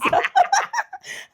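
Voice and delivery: high burst of laughter; amused squeal of a laugh. Real, not acted. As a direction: laugh out at the joke. Laughing at "old" joke.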